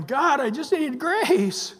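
high, drawn-out mock plea; slow, high-pitched comic begging; mock pleading. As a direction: cry out like someone helplessly adrift, hammed up. Acted plea while paddling in flood.

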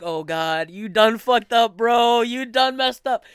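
loud, high, slow mock disbelief; hammed-up inner gasp of oops; mock exclaiming. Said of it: "I was like".

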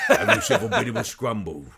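laughing Cockney voice; chirpy London accent, with a laugh. Pretend. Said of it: "that Cockney accent".

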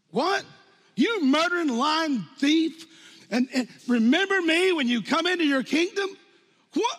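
high, loud disbelieving cry; incredulous outrage. Acted. Preacher mocking the thief's nerve, in loud disbelief. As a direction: act out shocked indignation at the thief.

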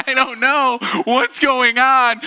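high, loud, slow whimpering cry; panicked child wail. Retold or imitated. A scared six-year-old to his mom, wailing high and slow. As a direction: wail it like a lost little kid.

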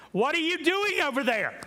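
high, loud, incredulous call; mock divine shout. Lord calls out to Elijah hiding in a cave, incredulous high shout. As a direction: yell it across a distance, pitch way up.

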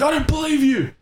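shouting at a stranger with a gun; defiant shout. Retold or imitated. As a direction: yell it straight at him. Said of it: "I actually yelled that to him".

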